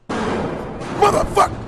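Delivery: long, high, loud scream; terrified scream. Real, not acted. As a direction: scream it out in terror. Jump scare in game.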